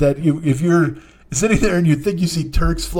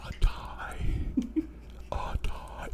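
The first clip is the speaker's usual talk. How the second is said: silent, breathy mouthing of the words; barely voiced, just shaping the words; mouthed whisper; 14 dB quieter, much slower than usual. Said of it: "she mouthed it".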